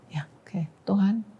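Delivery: soft, resigned assent; retold surrender. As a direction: give in quietly to God. Retold surrender to God in tears. (pasrah dengan suara pelan)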